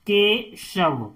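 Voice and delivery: "Keshav" slowly in pieces, के, then शव; "Keshav" drawn out for learners; syllable by syllable. Shown as a demonstration. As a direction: break "Keshav" after के with a short gap. Teacher splits word into syllables.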